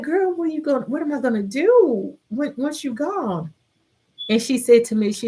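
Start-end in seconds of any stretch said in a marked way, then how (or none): 0.0-3.5 high, familiar pleading question; lightly acted, higher-pitched plea; retold girl talk. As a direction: retell asking Mom what to do. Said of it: "that's how we would talk".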